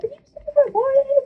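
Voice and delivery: very high mimicked kid voice; imitated little brother. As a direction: squeak it like a pestering little brother. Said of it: "my little bro ... and going".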